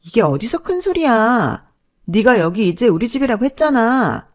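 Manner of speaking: a hint of a sneering older sister; contemptuous sister-in-law. Sister-in-law lashes out at her brother, sneering. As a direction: sneer and snap back.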